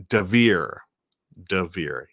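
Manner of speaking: Devere with stress on the long second syllable, "de-VEER"; corrected pronunciation. Shown as a demonstration. Streamer trying the name a viewer corrected, corrected pronunciation. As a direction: repeat Devere carefully, ending in a long "ee". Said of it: "thank you for correcting me".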